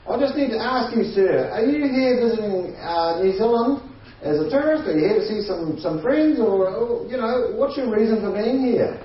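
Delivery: high, youthful official voice; squeaky young officer putting on formality; young customs officer. Retold or imitated. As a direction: question them as a pimply young officer. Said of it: "pimples, young".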